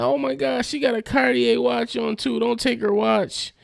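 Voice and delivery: very high-pitched hammed-up distress; over-dramatic. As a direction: imitate a drama queen pleading in falsetto. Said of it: "[name]'s being over dramatic".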